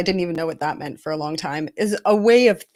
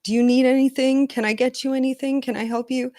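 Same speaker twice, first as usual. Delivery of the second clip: higher-pitched imitation of an eager helper; solicitous helper; 7 semitones higher, faster than usual. Imitating someone offering help to a sick friend, solicitous. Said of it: "someone was like".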